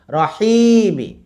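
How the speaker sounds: high, slow রহিমি with long হি; short র, sustain হি, quick মি; stretched middle. Shown as a demonstration. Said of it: "হিতে টান".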